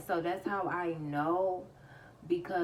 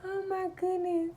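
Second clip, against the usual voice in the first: high squeak left over from laughing; real laughter squeezing the voice high; laughing to tears; 13 semitones higher, 5 dB louder than usual. Real, not acted. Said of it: "I'm about to cry".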